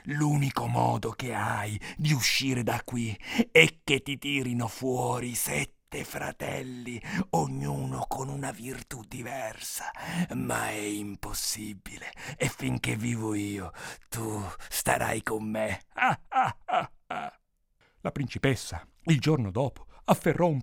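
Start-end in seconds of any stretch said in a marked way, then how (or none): 0.1-15.8 slow, hushed, whispery ogre voice; confiding ogre. A narrator's reading. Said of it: "l'orco una notte le confidò". 15.8-16.8 slow, separated villain laugh; acted gloating ogre cackle; ogre's laugh. A narrator's reading. Ogre gloats over captive princess.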